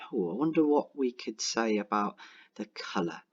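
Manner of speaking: usual speaking voice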